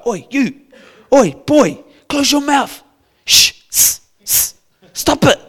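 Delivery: loud, high scolding shush; hushing crowd. Retold or imitated. Crowd scolding the blind beggar to be quiet. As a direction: tell the beggar to shut up. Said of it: "people start telling them to be quiet".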